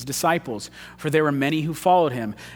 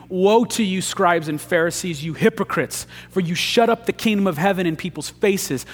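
preaching Jesus' woes; 4 semitones higher than usual. Acted. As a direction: hammer each woe like a prophet. Jesus' woes read aloud.

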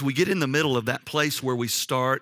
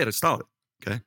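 usual speaking voice

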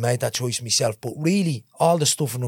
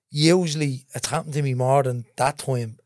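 ordinary talk, nothing marked

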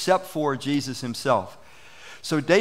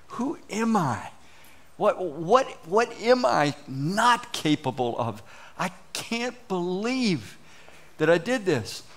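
slow, softer, higher dismay; stunned self-questioning; 4 semitones higher, 3 dB quieter, slower than usual. Retold or imitated. Pastor voicing someone sitting dejected in the corner. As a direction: drag it out slowly in disbelief.

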